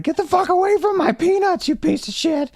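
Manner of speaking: put-on a tiny furious girlfriend groundhog yelling over peanuts; angry girlfriend groundhog. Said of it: "She's like".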